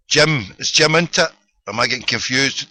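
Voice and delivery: ordinary talk, nothing marked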